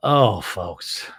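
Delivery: normal talking voice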